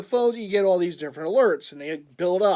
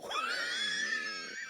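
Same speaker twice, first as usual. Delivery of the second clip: laughing; 12 dB quieter than usual. Real, not acted. Laughing at the hat.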